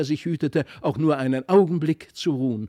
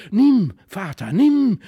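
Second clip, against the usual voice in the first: urgent, loud and higher; excited call; 5 semitones higher, 8 dB louder, slower than usual. A narrator's reading. Felix urging his father, loud and excited. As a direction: push the swatter on him eagerly. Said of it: "rief er".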